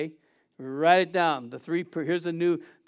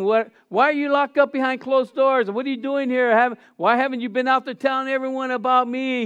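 higher, louder reproach; mock scolding; 7 semitones higher, 5 dB louder than usual. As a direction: play the Jesus who scolds. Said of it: "He doesn't ask them".

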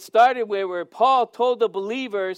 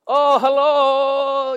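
drawn-out, higher, louder sing-song hello; mock flirty Adam; 5 semitones higher, 7 dB louder, much slower than usual. Preacher mocking Adam's flirty greeting in a commercial, slow and hammed-up. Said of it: "and he goes".

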